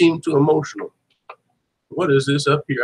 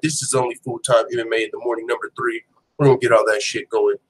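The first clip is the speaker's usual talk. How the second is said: usual speaking voice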